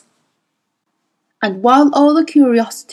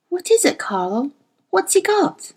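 higher, playful, coaxing woman's voice; Mrs Coulter, teasing; 9 semitones higher than usual. A narrator's reading. Mrs Coulter teasing Carlo into telling, high and playful. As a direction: tease him up high, insisting sweetly.

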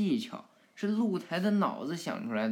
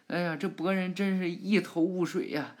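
plain reading voice

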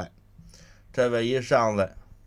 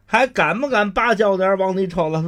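loud, raised scolding question; scolding threat; 8 semitones higher, 6 dB louder than usual. Acted. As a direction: dress him down like a lord. Master scolds the thug.

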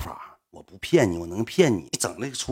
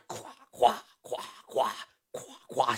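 imitated splashing noise; 11 dB quieter than usual. Imitating shower splash sounds.

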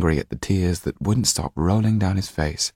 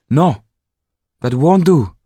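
sharp, higher and louder rebuke; 10 semitones higher, 8 dB louder than usual. A narrator's reading. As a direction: cut him off sharply. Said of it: "she said sharply".

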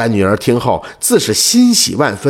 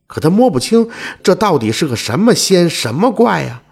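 ordinary delivery

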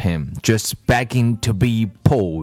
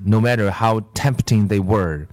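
ordinary delivery, nothing marked

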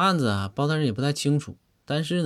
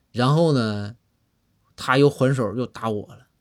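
normal talking voice

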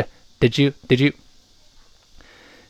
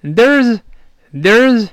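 "there's，there's" very slowly, higher and louder, every sound shown; slow clear there's; 7 semitones higher, 5 dB louder, much slower than usual. Shown as a demonstration. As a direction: draw out "there's" for the class. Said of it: "理论上是z".

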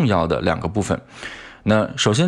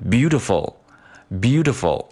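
beautiful with slow, drawn-out BEAU and quicker tiful; long first syllable; much slower than usual. Shown as a demonstration. Teacher highlights the stressed syllable, long first syllable. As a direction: draw out BEAU, then hurry the ending. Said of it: "相对要快一些".

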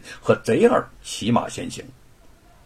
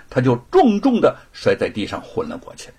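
usual speaking voice

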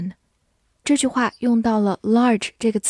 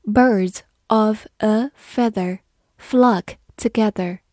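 "Birds of a feather flock together" said slowly, word by word; slow read; slower than usual. Shown as a demonstration. Teacher reads the proverb slowly to the class. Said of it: "我们慢慢来读".

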